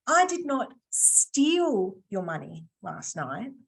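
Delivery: slow, with "steal" stretched out and set apart; stress on STEAL. Shown as a demonstration. Trainer hints she just borrowed it, stress on "steal". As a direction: dwell on "steal", leaving a hint behind it. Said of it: "So I didn't steal it".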